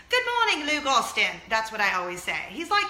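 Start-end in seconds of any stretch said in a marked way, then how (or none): 0.1-1.4 loud, high put-on British greeting; British accent. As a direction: call out a neighbor's name in hammed-up British. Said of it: "now speak British to him every time I see him".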